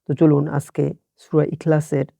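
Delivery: normal talking voice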